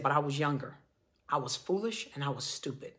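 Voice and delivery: low, soft confession, retold; near tears. Man admits his foolishness to coworker, retold as near tears. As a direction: imitate a remorseful man, subdued. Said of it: "he was basically in tears".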